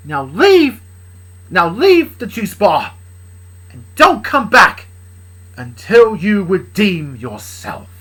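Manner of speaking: loud, slow banishing order; stern command. Acted. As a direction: throw him out, slowly and loudly. Banishing Blitz firmly.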